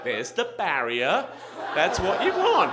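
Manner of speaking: slow, laughing, rising to loud; laughing announcement. Retold or imitated. Doctor in video showing the barrier, laughing. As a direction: point it out through laughter.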